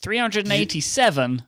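ordinary talk, nothing marked

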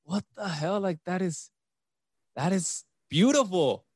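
high-pitched amazed what the hell; incredulous, pitched well up; amazed disbelief. Retold or imitated. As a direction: sound floored by it. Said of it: "and I'm like".

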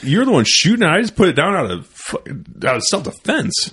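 high, loud scolding; dad scolding. Retold or imitated. Dad chewing out the careless shooter. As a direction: tear into the guy. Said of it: "He's like".

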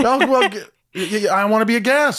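high, loud, stammering excitement; eager pleading. Real, not acted. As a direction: jump in loudly, begging to be included. Host eagerly begs to join podcast.

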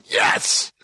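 voiceless, rasped mock cheer; hushed yell of triumph; retold celebration. Retold reaction to whispered baby news.

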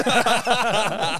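said through real laughter, slow and loud; amused laughing admission. Real, not acted. Laughing at dad's remark.

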